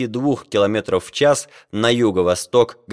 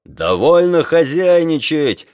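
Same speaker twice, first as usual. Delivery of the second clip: high, slow, forceful rebuke; angry yell; 6 semitones higher, 4 dB louder than usual. A narrator's reading. Sailor confronting scientist face to face, yelling. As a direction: yell it right at him. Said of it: "заорал ему в лицо матрос".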